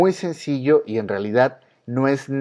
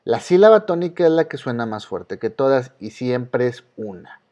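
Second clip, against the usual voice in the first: ordinary delivery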